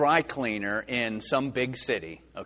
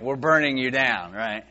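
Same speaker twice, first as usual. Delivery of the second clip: ordinary delivery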